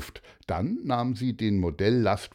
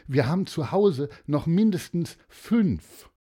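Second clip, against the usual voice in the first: higher-pitched, quick-thinking persuasion; eager Martin; 8 semitones higher than usual. Acted. Martin offering Daria his toys, higher and eager. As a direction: talk them into it, pitched up. Child's offer voiced in story.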